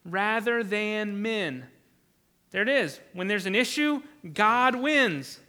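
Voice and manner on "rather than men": very slow, each word weighted; slow solemn declaration. Retold or imitated. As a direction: declare it with calm resolve. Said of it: "Peter and the apostles reply".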